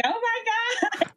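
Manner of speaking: high, slow, stunned exclamation; a drawn-out gasp of emotion, high up; overwhelmed oh my god. Retold or imitated. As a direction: replay the moment you were moved to tears. Retells crying on meeting Lisa.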